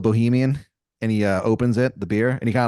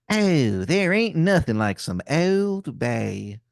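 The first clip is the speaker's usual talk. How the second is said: slow, higher Maryland drawl; Wizard of Baltimore, bad Maryland accent; 4 semitones higher, slower than usual. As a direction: savour each vowel in a bad Maryland accent. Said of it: "this man's accent is something".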